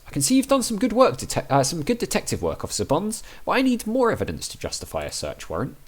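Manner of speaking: a touch of a formal judge. Acted. Player voicing the judge refusing the warrant, lightly formal.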